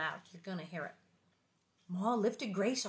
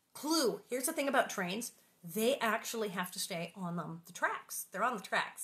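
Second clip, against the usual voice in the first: plain reading voice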